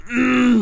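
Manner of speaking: high, loud frustrated "mm"; pissed-off growl. Retold or imitated. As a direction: groan loudly through closed lips, pissed. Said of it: "I'm fucking pissed".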